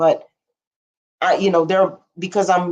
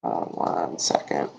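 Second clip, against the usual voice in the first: low whispered aside; whispering; 9 dB quieter, slower than usual. Real, not acted. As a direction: whisper it away from the mic. Aside while checking something off-mic.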